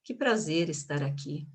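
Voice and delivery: low, lifeless delivery with no energy; unenthusiastic. Shown as a demonstration. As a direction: say the welcome as if you don't mean it. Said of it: "Eu não poderia dizer". (sem energia, desanimado)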